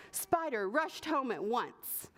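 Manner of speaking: usual speaking voice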